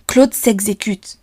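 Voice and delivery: ordinary delivery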